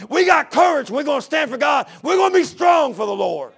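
rousing battle-cry preaching; rallying shout. Acted. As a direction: bellow it to stir the crowd to fight. Caleb rallies the fearful people.

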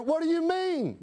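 ordinary talk, nothing marked

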